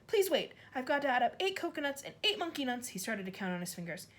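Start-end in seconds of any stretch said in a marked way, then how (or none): 0.1-2.9 higher, frantic plea; a panicking boy counting; flustered plea. A narrator's reading. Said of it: "he cried".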